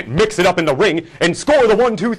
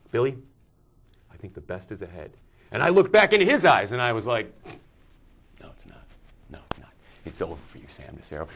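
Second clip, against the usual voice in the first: hushed quote; 8 semitones lower, 5 dB quieter than usual. Retold or imitated. Quoted hushed exchange with Sam.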